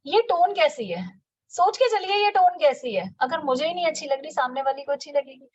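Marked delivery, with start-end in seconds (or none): none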